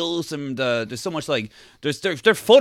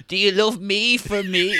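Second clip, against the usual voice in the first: slow, pleading mock lover; needy groom; 5 semitones higher, slower than usual. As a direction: ask it slow and needy, half-mocking. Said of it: "But he's still like".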